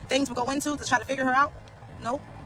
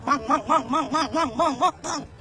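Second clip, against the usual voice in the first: mock slapping sound; rhythmic mong noise; slower than usual. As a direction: chant "mong" like a comic sound effect.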